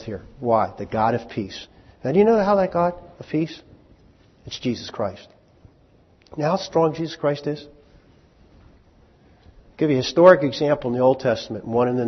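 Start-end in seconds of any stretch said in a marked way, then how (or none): none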